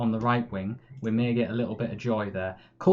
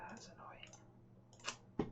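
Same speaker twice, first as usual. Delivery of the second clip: very slow, breathy whispered sigh of annoyance; sighing whisper of frustration; 25 dB quieter, much slower than usual. Real, not acted. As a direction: let it out under the breath, deflated. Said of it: "I'm getting annoyed".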